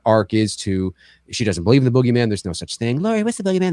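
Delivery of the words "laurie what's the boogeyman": put-on high child's voice asking; imitating a child. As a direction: ask like little Tommy. Tommy voiced in retelling.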